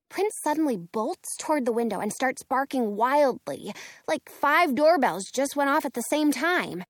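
plain reading voice

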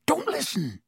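high, hoarse urgent order. A narrator's reading. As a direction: urge them away in a strained, hoarse voice. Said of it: "he said hoarsely".

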